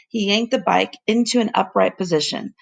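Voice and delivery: ordinary delivery, nothing marked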